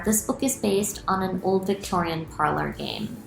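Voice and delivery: ordinary delivery, nothing marked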